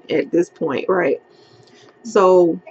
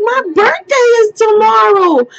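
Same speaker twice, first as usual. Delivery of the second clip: high, panicked and fast, near tears; frantic pleading; 14 semitones higher, 4 dB louder than usual. Retold or imitated. Arrested driver pleading with the officer, high and panicked. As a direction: beg the officer like you can't breathe. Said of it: "like, freaking the fuck out".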